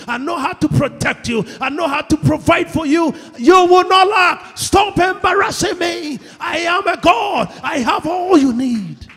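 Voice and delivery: loud, high shouted proclamation as God; preacher voicing God at full force; God rebuking. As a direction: thunder it at your doubting envoy. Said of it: "and God is sitting there".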